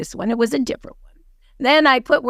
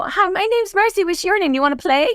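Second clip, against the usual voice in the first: put-on high, sing-song child hello; kindergartner; 7 semitones higher than usual. Recalling kindergarten introductions, high childlike sing-song. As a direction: say it like a little kid on the playground. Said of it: "like kindergarten".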